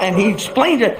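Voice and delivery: ordinary delivery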